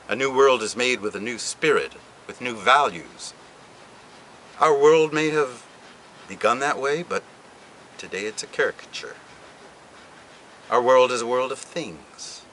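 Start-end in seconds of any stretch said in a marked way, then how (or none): none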